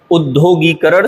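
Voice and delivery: उद्योगीकरण starting on short u, with long gee; correct pronunciation. Shown as a demonstration. Teacher correcting it, उद्योगीकरण said with initial u. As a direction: open उद्योगीकरण with ud-dyo. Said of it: "सही है".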